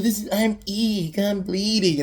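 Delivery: high-pitched, loud hammed-up victim; mock overselling. Stabbed victim to the killer, hammed-up overselling. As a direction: oversell the injury like a bad actress. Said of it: "she's just overselling it".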